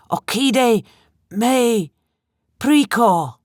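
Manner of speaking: high, pleading foreign voice; strange Italian-like accent. A narrator's reading. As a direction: plead in an odd Italian-sounding tongue. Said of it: "he pleaded in a strange, almost Italian accent".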